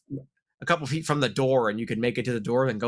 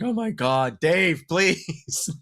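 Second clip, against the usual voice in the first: exasperated wolf; 3 semitones higher, much slower than usual. Retold or imitated. Wolves complaining to a friend.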